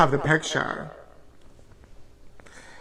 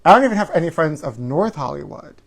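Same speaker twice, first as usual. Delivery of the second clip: ordinary delivery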